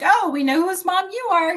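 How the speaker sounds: high, loud, teasing recognition; knowing exclamation. Retold or imitated. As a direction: imitate others gleefully spotting the resemblance. Voicing people at parent-teacher meetings.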